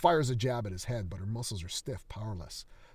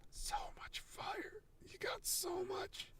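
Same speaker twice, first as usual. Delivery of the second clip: slow, much higher, whispered wraith voice; ghastly rasping whisper; 11 dB quieter, slower than usual. Acted. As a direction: rasp it like a starved ghoul. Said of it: "hasps in the corner".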